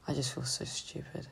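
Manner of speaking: a breathy, defeated whisper; whispered self-blame. Real, not acted. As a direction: let the words almost disappear, beaten down. Low point, self-blame.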